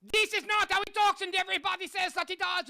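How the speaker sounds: squeaky cartoon-villain Hitler, mocked; high-pitched mocking Hitler impression. Shown as a demonstration. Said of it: "he does not talk like this".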